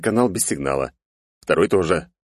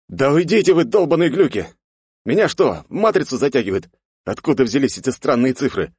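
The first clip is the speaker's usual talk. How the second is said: panicked shout; 7 semitones higher than usual. Acted. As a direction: shout in alarm at the visions.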